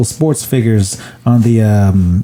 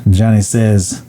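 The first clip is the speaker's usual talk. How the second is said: ordinary talk, nothing marked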